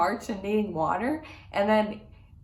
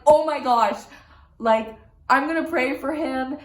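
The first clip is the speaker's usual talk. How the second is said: louder, higher, delighted; excited; 5 semitones higher, 8 dB louder than usual. Retold or imitated. Vlogger hears about the son's retreat, excited, louder and higher. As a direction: burst out with excitement.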